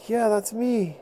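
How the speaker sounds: put-on high, slow, pleading boy voice; pleading little boy. Little boy pleading with the mammoth not to charge.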